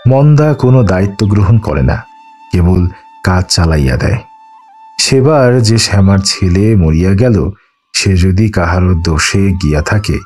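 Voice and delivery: plain reading voice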